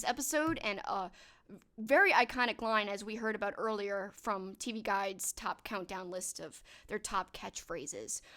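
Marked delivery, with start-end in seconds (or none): none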